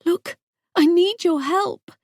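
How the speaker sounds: voice catching. A narrator's reading. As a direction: push the plea until the voice catches. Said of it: "my voice catches".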